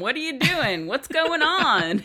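loud, high-pitched grilling; hammed-up loud demanding questions; mock interrogation. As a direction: fire off questions like a nosy sheriff, voice rising. Acted barrage of sheriff's questions.

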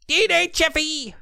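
very high, drawn-out mock South African-Australian accent; hammed-up, squeaky-high attempt at a South African accent; bad South African accent. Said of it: "That's not very South African".

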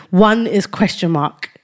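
usual speaking voice

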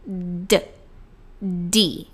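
"D" said as an airy d sound; puffed D. Shown as a demonstration. Teacher separating D from G, puffed d. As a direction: release "D" with a little puff of air. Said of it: "ele é soprado".

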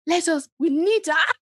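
mocking her pastor dad. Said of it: "why do you have to mock your dad like that".

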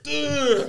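high, loud mock heave; imitated retch. Storyteller mimicking the Navy guy throwing up, imitated retch. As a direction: heave it out high while laughing.

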